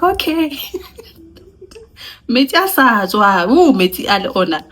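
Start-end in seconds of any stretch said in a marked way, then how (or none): 0.0-0.6 high laughing okay; laughing okay to sister-in-law. Retold or imitated. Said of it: "I'm like".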